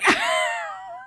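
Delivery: very high, held squealing laugh; high-pitched squeal. Real, not acted. Reacting to fifteen maddening minutes, high squealing laugh. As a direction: let out a long high squeal. Exasperated outburst after "ready to do it.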